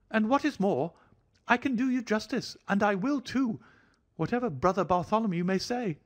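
a hypochondriac begging for reassurance; fretful thin high voice. A narrator's reading. Nervous host pleading with the doctor about his heart, thin and high. Sholto speaks.

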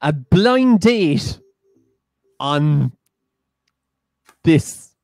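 slow, drawn-out proposal with pauses; deliberate pitch. Pretend. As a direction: spell out the idea grandly, word by word. Mock presenter pitches sudden idea.

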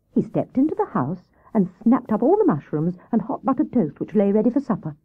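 plain reading voice

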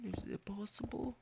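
a sudden high, soft whispered aside; playful whisper wondering about subscribers. Real, not acted. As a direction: whisper it to yourself like a secret. Joking aside to himself.

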